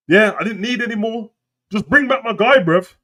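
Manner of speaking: high-pitched, exasperated pleading; fed-up plea. Acted.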